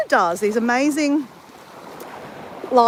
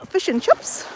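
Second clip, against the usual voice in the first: mock Kiwi fish and chips, higher; New Zealand accent; 8 semitones higher, slower than usual. Teasing a Kiwi friend, lightly imitating her New Zealand accent. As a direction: say "Fish and chips" the Kiwi way, vowels squeezed toward "fush and chups". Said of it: "She says".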